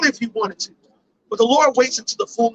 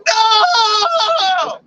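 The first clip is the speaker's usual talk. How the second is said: anguished cry; 8 semitones higher, 3 dB louder than usual. Acted. Imagined loser crying out.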